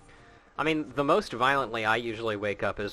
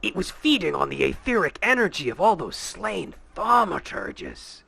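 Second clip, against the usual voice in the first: lightly voiced sinister schemer; sly villain; 7 semitones higher than usual. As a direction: read it as a smug villain. Reads villain dialogue in character.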